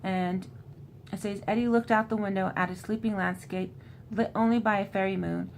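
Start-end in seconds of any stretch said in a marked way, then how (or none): none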